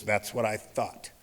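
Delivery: slow, low, quiet detective's comeback; understated authority, unhurried and soft; smug put-down. Retold or imitated. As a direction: let it land slowly, low and knowing. Acted detective's knowing put-down.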